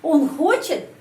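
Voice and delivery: хочет lifted high on its first syllable; questioning pitch peak on хочет; question intonation. Shown as a demonstration. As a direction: ask by raising хочет sharply. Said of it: "saya menyusun kalimat tanya".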